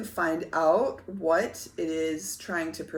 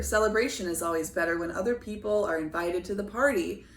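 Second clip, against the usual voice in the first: usual speaking voice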